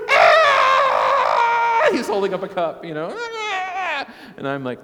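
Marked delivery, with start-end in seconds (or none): 0.1-1.9 long, very high, loud mock shriek; imitated toddler scream. Four-year-old wanting milk, imitated wordless scream. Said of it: "his emotions get the best of him". 3.1-4.1 high, loud mock child's wail; imitated toddler wail. Four-year-old holding up a cup, imitated wail. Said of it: "his emotions get the best of him".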